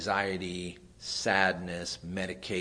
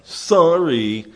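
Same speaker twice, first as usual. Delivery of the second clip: high, loud, whiny mock kid; child forced to apologise, mock sulky sorry; grudging child's sorry; 8 semitones higher, 14 dB louder than usual. Child told to apologise to sister.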